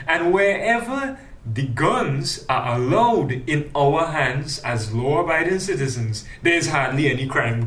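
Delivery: normal talking voice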